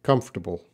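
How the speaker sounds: Comfortable as KUMF-ter-bul, three syllables, no middle t; American pronunciation. Shown as a demonstration. Teacher models Comfortable for a student. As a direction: say Comfortable clipped and quick, t dropped. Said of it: "it should be pronounced comfortable".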